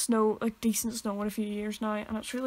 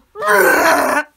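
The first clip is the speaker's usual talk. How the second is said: a loud dinosaur-like creak through laughter; hammed-up loud creaky box roar; loud imitated box noise; 4 semitones higher, 15 dB louder than usual.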